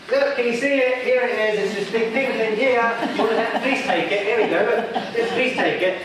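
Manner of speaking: high, laughing pleading dog voice. Retold or imitated. Dog pleading with its owner to take the treat, high and laughing. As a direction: beg sweetly, high and giggly. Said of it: "And the dog goes".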